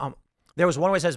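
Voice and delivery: normal talking voice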